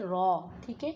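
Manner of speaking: ordinary talk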